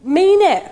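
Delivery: high, loud and firm on mean it; forceful insistence; emphatic. Shown as a demonstration. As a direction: punch mean it with conviction. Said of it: "mean what you say".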